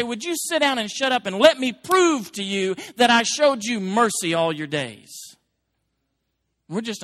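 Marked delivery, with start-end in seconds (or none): 0.0-5.1 stern voice of God. Acted. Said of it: "God's gonna say".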